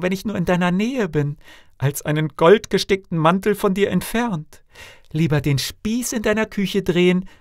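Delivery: high-pitched pleading princess; devoted daughter, pleading. A narrator's reading. Princess vows to her father never to leave him, high earnest pleading.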